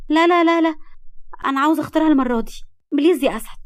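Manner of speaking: high, loud rapid no's; pleading protest. A narrator's reading. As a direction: wheedle him like an insistent girl. Girl protests and begs to choose.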